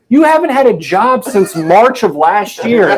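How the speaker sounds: high, loud laughing accusation; hammed-up accusing wife; mock wife. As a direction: throw it at him laughing.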